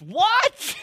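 very high, loud shriek of disbelief; squawked, appalled exclamation; incredulous outburst. Acted. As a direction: explode in hammed-up outrage. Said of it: "what does Paul say next".

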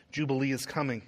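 ordinary talk

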